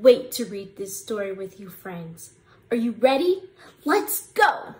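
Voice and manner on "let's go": usual speaking voice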